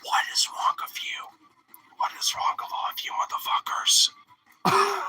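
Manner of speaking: soft whispered exasperation, last word jumps high; exasperated whisper. Retold or imitated. Vlogger thinks to himself about conservatives, exasperated whisper. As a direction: whisper it to yourself in disbelief. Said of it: "I'm thinking here to myself".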